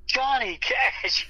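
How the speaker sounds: put-on very high, drawn-out little-kid squeal; excited little kid. Five-year-old self reacting to [name], high excited kid voice. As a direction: stretch it out like a thrilled small child. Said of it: "And I'm going".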